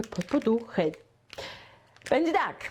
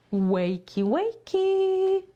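sing-song wake-up call; 8 semitones higher, much slower than usual. Shown as a demonstration. Teacher demonstrating a cheerful wake-up call to students. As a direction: call wakey up high like waking a child.

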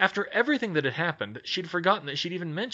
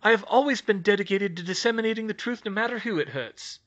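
a hint of a sly gossip reporter; lightly voiced journalist; 8 semitones higher, 3 dB louder than usual. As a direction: Skeeter offering help with an angle. Skeeter self-righteous.

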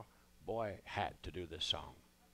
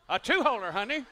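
a much higher, louder repeat of the word; incredulous; 10 semitones higher, 6 dB louder than usual. Acted. Husband, disbelieving, repeats what his wife brought him. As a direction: say "two-holer" high in disbelief.

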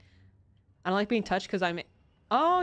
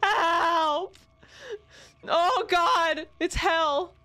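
panicked high yelps; frantic cries for help while fleeing; being chased; 9 semitones higher, much slower than usual. Real, not acted. Chased by monsters, screaming.

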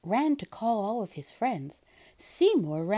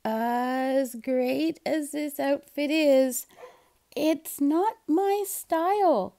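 Seymour's slow voice; 5 semitones higher, slower than usual. A narrator's reading. Seymour politely refuses.

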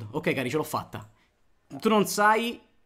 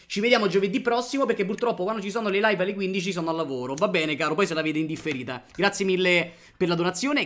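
ordinary talk

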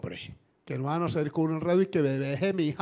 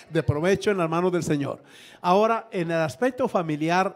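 louder, driving pulpit delivery; fervent preaching; 5 dB louder than usual. Real, not acted. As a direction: preach it to the congregation with force. Preaching with rising force.

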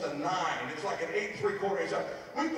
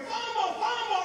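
yelled much higher; screaming fumble; 12 semitones higher, 3 dB louder than usual. Retold or imitated. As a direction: call it out like a player on the field. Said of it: "The guy starts screaming".

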